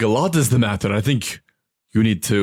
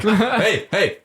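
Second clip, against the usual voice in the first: tugging hey; 11 semitones higher than usual. Acted. Tugging the guy's sleeve.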